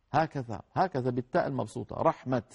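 ordinary talk, nothing marked

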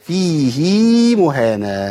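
فيه as "feehee", long ee after ه; slow chanted فيه مهانة, stretching the hi; linked with ya. Shown as a demonstration. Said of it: "وصلت بإيه؟ بياء".

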